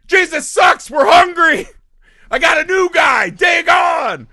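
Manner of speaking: hammed-up zealot screaming his new god; mock fanatic shout. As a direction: yell it like a crazed convert.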